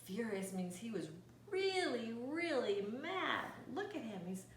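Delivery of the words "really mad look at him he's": ordinary delivery, nothing marked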